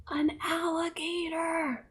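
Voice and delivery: hushed, suspenseful reveal; awed whisper. Acted. Storyteller reveals who said aha, suspenseful whisper. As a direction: whisper it after a gasp.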